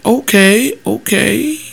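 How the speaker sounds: high, slow, deadpan question; blunt pastor question. Retold or imitated. Pastor retelling his blunt question to the confessing man, slow and deadpan. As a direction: ask it dead straight, drawing it out slowly. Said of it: "I said".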